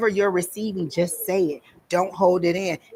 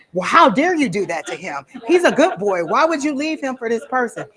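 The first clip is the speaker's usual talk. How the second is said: indignant scolding; 6 semitones higher than usual. Retold or imitated. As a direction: tell her off on his behalf. Scolding the girl who left.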